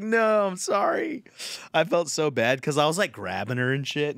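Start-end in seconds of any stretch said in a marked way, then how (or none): 0.0-1.2 high, drawn-out retold apology; mock apologetic wail. Husband retelling his apology to his wife, mock apologetic wail. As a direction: plead sorry in a comically high voice.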